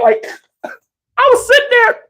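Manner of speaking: laughing high while telling it. Real, not acted. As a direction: laugh through the words, voice up high. Laughs recalling neck pain.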